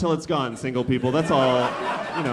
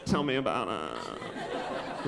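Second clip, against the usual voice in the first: mock sympathy; 2 semitones lower, 9 dB quieter, much slower than usual. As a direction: slowly feign weary concern under your breath. Feigned sympathy for childless friend's bad day.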